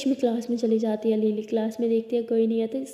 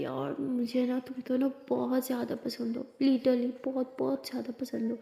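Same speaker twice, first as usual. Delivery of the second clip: much softer, affectionate; fond gushing; 8 dB quieter than usual. Acted. Diya tells Lakshmi and Lily how much she adores them, soft and fond. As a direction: dote on the pair dreamily. Diya gushes over friends.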